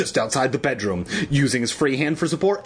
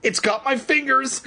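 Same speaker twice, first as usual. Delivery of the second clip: very high, hammed-up panic; shrill cry; 13 semitones higher than usual. A narrator's reading. Evan stuck in the goo, crying out shrilly. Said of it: "Evan cried shrilly".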